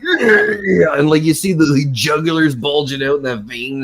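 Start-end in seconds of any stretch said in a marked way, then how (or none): 0.0-0.9 tense wrestler voice. Retold or imitated. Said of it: "he was so tense".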